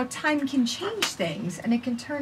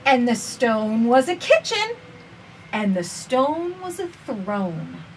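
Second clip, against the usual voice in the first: gentle, measured storybook reading; reading to children; slower than usual. Acted. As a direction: read it slowly to little listeners. Reads picture book aloud.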